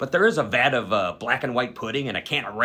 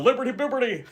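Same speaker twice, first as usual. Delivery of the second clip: Wes yelling; 11 semitones higher than usual. Retold or imitated. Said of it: "I hear Wes from the other room yell".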